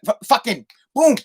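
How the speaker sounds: normal talking voice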